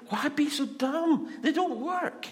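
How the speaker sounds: high-pitched exasperated prophet paraphrase; exasperated rebuke. Retold or imitated. Prophet telling the king his gods are useless, exasperated. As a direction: plead at how stupid this is, pitched right up.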